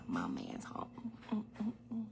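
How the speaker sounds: whispered note that her man is home. Real, not acted. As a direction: hush it, he just walked in. Partner arrives, whispers.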